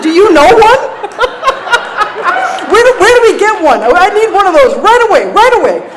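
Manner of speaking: very high, loud laughing plea; desperate eagerness. Retold or imitated. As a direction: beg for a sponsor right away. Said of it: "I said".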